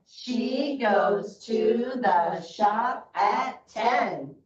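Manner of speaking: very slow, word by word; slow choral reading. Shown as a demonstration. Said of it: "so it's gonna go like this".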